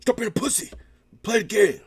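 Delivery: high, loud drill sergeant bark. Retold or imitated. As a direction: yell orders like a sergeant. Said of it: "he sound like a drill sergeant".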